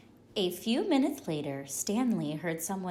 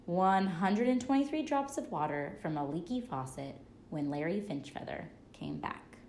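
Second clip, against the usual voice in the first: usual speaking voice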